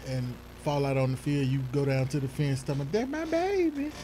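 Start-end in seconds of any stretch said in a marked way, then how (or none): 2.6-3.9 mock cheering mom. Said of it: "were you that mama that".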